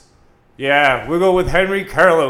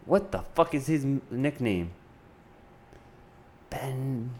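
usual speaking voice

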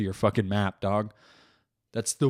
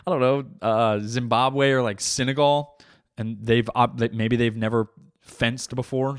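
ordinary delivery

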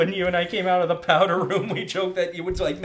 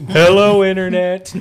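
loud greeting; 3 semitones higher, 11 dB louder, slower than usual. Pretend. Greeting camera.

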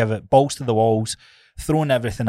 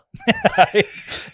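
laughing; 11 semitones higher, 3 dB louder than usual. Real, not acted. High laugh at the joke.

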